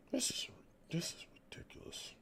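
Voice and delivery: whispered under his breath in disbelief; disgusted whisper. Real, not acted. Fan whispering his disgust at the team. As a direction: breathe it out, too fed up to speak aloud.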